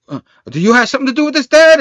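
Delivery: high, rushed demand climbing to a loud finish; mock-agitated quote, rising sharply on "Dad"; agitated questioning. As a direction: blurt the question fast and high. Acted alarmed question to Dad.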